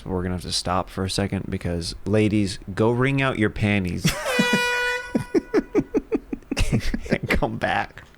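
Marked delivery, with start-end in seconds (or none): 4.2-7.1 squealing high laugh; high-pitched laugh. Real, not acted. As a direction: let out a high shriek of laughter. Host laughs loudly.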